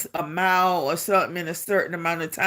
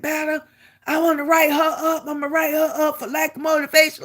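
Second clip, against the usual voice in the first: imitated complaining sergeant; 8 semitones higher, faster than usual. Sergeant reporting her to another sergeant.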